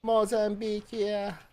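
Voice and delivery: put-on slow, high Jamaican accent. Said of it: "you had to do it in the, in the Jamaican, accent".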